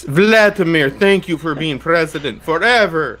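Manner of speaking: high, loud pretend adulation; mock tribute. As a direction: hail the leader in hammed-up praise. Mock tribute to Russian president.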